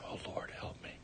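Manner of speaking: breathy little whisper of a prayer; whispered weak prayer. Shown as a demonstration. Preacher mocking a timid prayer, whispered. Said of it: "he's not just saying".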